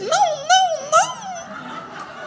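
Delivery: very high, thin repeated no; tiny falsetto, fading away; mock squeaky no. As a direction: squeak out a string of comic refusals.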